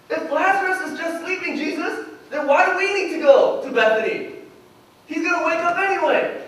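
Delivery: high-pitched questioning pushback; baffled objection. Retold or imitated. Disciples argue back at Jesus.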